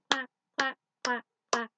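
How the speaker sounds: loud sung duck quacks. Acted. Teacher singing mother duck's quacks loud.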